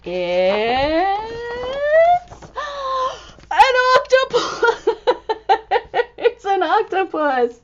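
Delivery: high gasping, laughing exclamation; astonished reveal. Acted. Reader to children, high astonished gasp.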